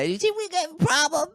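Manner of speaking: mock menace; tough guy heckler. Little Italian heckler challenging the comic, hammed-up threat.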